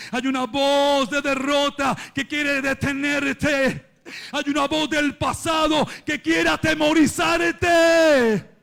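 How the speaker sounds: rising to a high shout; swelling pulpit cry; preaching at full force. Real, not acted. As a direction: warn the congregation with mounting force.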